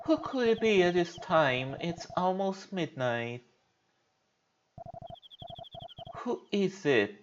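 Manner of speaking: theme park princess. Acted. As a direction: read it like a storybook princess. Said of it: "Gotta get to the prince's voice one more time".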